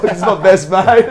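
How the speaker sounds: high, loud, elated retold shout; excited boast. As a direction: crow it loudly to the boys, pitched well up. Excited retelling of big win.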